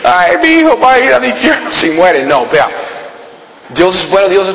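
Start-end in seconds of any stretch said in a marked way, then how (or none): 0.0-2.2 higher, mock fretful parent voice; mock worried parent. Preacher mimics parents fearing for their baby, mock fretful. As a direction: imitate a parent fretting over a baby.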